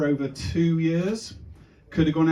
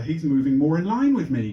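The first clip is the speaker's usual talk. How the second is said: ordinary talk, nothing marked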